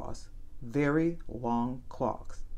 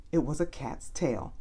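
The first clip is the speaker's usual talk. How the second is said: usual speaking voice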